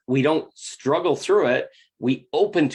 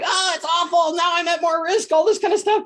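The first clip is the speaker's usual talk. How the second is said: mock panicked sister. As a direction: overplay the sister's alarm, much higher. Mocking the sister's panicked complaint.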